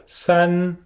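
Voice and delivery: "san" held high and level; first tone. Shown as a demonstration. Teacher models "san" for students: high level tone. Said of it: "On le fait bien haut".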